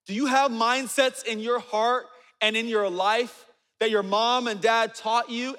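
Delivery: normal talking voice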